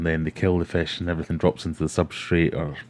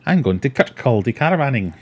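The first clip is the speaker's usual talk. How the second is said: higher, louder hammed-up Teuchter Scottish accent; mock Scottish accent; 7 semitones higher, 6 dB louder than usual. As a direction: do the classmate as a broad rural Scot. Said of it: "for my terrible accent".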